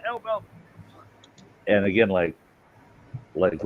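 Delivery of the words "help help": very high, frantic cries for help; mock drowning screams. Young guy splashing, faking drowning, screaming high for help. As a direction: shriek for help like a drowning teen.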